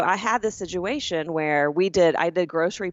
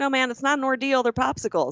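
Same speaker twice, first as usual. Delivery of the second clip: incredulous brush-off, pitched up; exasperated, higher; 7 semitones higher than usual. Retold or imitated. Customer brushing off the agent's fuss, incredulous and high. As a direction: laugh it off in disbelief. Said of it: "I'm like".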